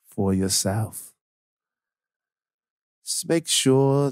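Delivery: low, slow meditation-guide voice; Headspace guy. Retold or imitated. Imitating a meditation app host for the co-host, hushed guidance. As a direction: ease each phrase out slowly, with long pauses. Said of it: "that's how he sounds".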